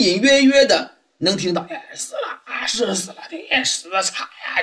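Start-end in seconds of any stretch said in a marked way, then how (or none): 1.7-4.6 crowd gossiping under their breath at a teahouse, softer murmur; hushed gossip. A narrator's reading. Teahouse patrons gossiping about a murder, hushed and soft. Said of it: "就看见大家在那嘀嘀咕咕".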